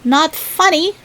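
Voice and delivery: high huffy harrumph; a grumpy harrumph, pitched high. A narrator's reading. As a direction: Rabbit brushing off Tigger's joke with a huff. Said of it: "harumphed Rabbit".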